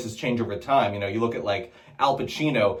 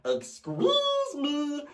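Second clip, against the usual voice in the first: Jar Jar Binks; 13 semitones higher, 6 dB quieter, much slower than usual. Retold or imitated. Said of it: "sounds a little bit like".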